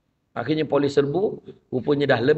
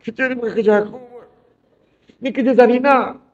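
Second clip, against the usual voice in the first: lightly voiced whiny worker, passing the blame; complaining worker; 6 semitones higher, 3 dB louder than usual. Coworker complains the job is Zarina's, mildly whiny. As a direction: push the blame off onto Zarina, a touch put-on. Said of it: "siap mengadu".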